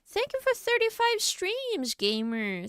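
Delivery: bright high grateful thanks; excited thanks. Real, not acted. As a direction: thank them with a happy lift.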